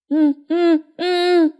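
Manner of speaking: high, drawn-out wordless hums; insistent grunting in place of speech; mute man's grunts. A narrator's reading. Mute son grunts his reply. (ậm ừ như người câm)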